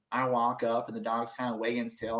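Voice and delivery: ordinary talk, nothing marked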